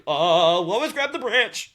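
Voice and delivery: high, loud, drawn-out shout; mock urgent call. Acting Superman calling to drowning Lois, high mock shout. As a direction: call out in hammed-up alarm, slowly.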